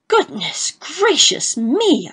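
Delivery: slow, high, drawn-out gasp of shock; startled exclamation. A narrator's reading. Husband seeing his wife through spectacles, high shocked exclamation. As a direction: recoil at what the spectacles reveal. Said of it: "I exclaimed".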